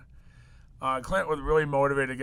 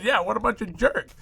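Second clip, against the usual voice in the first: mock commiseration; 7 semitones higher than usual. Mock joining in on customers' complaints.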